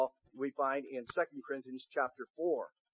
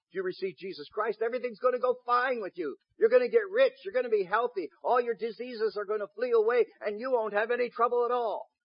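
high, loud overblown promises; hammed-up prosperity pitch; mock prosperity preacher; 8 semitones higher, 6 dB louder than usual. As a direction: sell the promise with mocking zeal.

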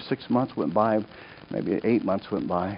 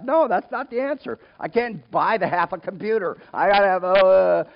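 high, loud whining objection; hammed-up agitated outburst; exasperated protest; 10 semitones higher, 7 dB louder than usual. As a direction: argue back in mounting frustration. Acts out wrestling over the money.

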